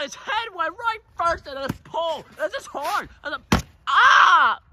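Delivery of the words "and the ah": slow, loud, high cry of pain; pained shout. Real, not acted. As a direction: yelp as your hand hits the pole. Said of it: "I just smacked that pole".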